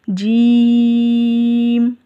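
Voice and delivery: जीम stretched very long on the ई, no nasal sound; long drawn jeem. Shown as a demonstration. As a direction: hold जीम out for five alifs. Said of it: "तीन या पाँच अलिफ के जितना लंबा". (जीम को पाँच अलिफ जितना खींचकर)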